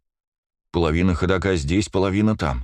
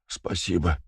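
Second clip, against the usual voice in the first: faint weak whisper; 6 dB quieter, slower than usual. Acted. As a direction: breathe it out, barely voiced. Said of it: "прошептал я".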